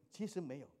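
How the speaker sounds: ordinary delivery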